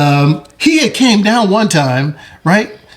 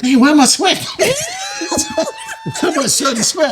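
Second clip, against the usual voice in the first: laughing nervously; faster than usual. Retold or imitated. Nervous before meeting Michael.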